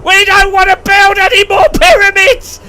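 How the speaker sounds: hammed-up sobbing yell of revolt; fed-up people. People of Egypt protesting against the pharaoh, hammed-up wailing shout. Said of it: "they're like".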